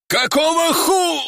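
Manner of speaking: high, drawn-out cry cut off; horrified shock. Acted. Commander sees his heart in a claw, horrified shock. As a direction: cry out slowly in dying disbelief.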